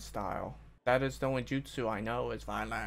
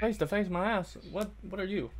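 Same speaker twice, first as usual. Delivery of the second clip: mocking echo; 8 semitones higher than usual. Real, not acted. Mocks game character's line.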